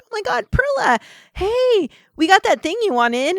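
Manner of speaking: very high, loud imitated squeal; mock gushing greeting. Imitating makeup rep greeting favorite customer, gushing. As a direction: gush like a sales rep spotting a regular.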